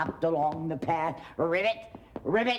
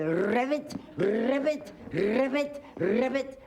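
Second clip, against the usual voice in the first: high rising ribbits; high frog croaks; 5 semitones higher than usual. Acted. Grandma playing the frog after the kiss, croaking high. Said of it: "And the frog said".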